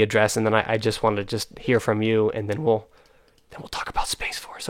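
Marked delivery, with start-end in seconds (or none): 3.5-4.7 breathy teasing whisper. Real, not acted. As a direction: whisper it like a secret aside. Aside teasing the next topic.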